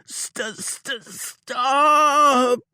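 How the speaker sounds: stammering high shriek of stop; stuttering cry of agony, high and loud; pained cry. A narrator's reading. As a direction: choke out stop through the pain. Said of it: "howled like a gut-shot coyote".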